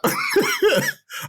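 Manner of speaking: short, high-pitched laugh. Real, not acted. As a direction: laugh lightly at your own remark. Laughs at own remark.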